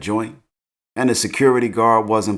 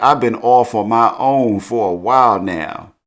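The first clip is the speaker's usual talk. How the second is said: [name]; 2 semitones higher, 5 dB louder than usual. Retold or imitated. Girl answers her young admirer, mock R&B delivery. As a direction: push it out like an R&B line. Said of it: "Sounded like [name]".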